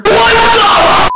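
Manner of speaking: surprised shout. Acted. Shocked outburst.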